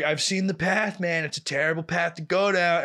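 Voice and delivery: hammed-up life-lesson from the boss; preachy ex-con boss. He was like.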